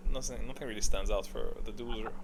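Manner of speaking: ordinary talk, nothing marked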